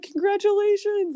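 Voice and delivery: very high, beaming congratulations; excited congratulations. Retold or imitated. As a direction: burst out with a happy cheer. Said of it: "she was like".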